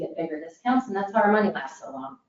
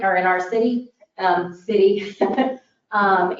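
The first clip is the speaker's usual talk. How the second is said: ordinary talk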